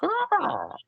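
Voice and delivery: high piercing raven caw. Acted. The raven calls out to the party with a piercing caw. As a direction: squawk it like a raven. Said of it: "calls out".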